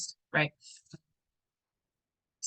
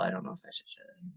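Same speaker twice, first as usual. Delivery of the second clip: whispered aside; 12 dB quieter, faster than usual. Real, not acted. Private aside while teaching.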